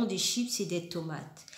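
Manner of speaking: usual speaking voice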